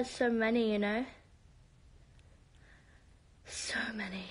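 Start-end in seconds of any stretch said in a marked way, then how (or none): 3.5-4.2 whispered to herself; soft whispered repeat; whispered aside. Real, not acted. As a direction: drop to a whisper.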